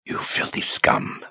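slow, soft, breathy whisper of contempt; murmured. Acted. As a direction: spit it under your breath. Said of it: "she murmured to herself".

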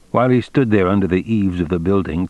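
ordinary delivery

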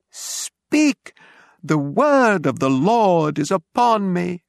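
very high, drawn-out prophetic cry; shouting at full voice. A narrator's reading. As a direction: proclaim it slowly like a frenzied preacher. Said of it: "at the top of his voice".

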